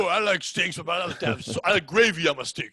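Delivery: hammed-up Italian-American wiseguy; Italian mobster voice. Riffing on steaks as a mafia guy, Italian accent. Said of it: "I don't do the other Italian voice".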